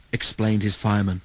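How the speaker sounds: plain reading voice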